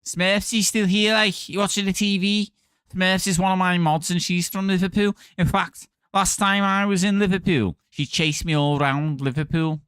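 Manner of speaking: high-pitched Scouse accent; Liverpool accent. Shown as a demonstration. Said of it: "try and do your accent".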